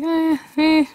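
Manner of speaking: high, doubtful little "eh"; high-pitched murmur of puzzlement; puzzled noises. Retold or imitated. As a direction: squeak out an unsure hum. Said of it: "I'm like".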